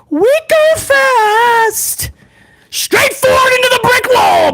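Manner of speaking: high, drawn-out hype shout; stretched-out battle cry; hype catchphrase. Pretend. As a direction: holler it like a show intro.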